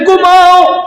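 high, called-out order to sleep; emphatic command. Acted. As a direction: call the word out high and forceful. Bird repeats sleep, crying out.

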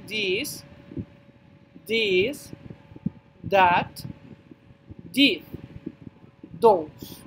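each word said slowly and apart, this, that, this, those; teacher models this, that, those for the class; pronunciation demo. Shown as a demonstration. Teacher to students, modelling each word slowly. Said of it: "Escuchen la pronunciación otra vez".

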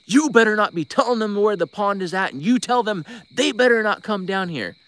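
high, loud imitated scolding; angry warning call. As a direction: shout it like a furious warning down the phone. Retelling pastor's angry phone warning.

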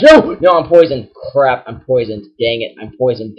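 normal talking voice

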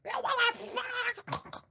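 high mock screech, slowed and dragged out; retold screaming partner. Streamer acting out the couple's fight in a high, dragged mock scream. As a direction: ham up the screaming girlfriend. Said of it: "she's like".

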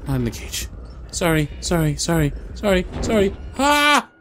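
rising, loud, desperate sorries ending in a scream; frantic pleading. Real, not acted. Captured, begging.

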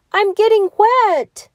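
high, loud worried cry. A narrator's reading. Gingerbread man cries out to the fox about the water. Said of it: "said the gingerbread man".

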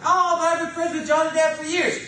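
high, loud mock protest; whiny excuse voice. Mimicking a congregant who defends his friend, whiny and loud. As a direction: whine it like a defensive churchgoer.

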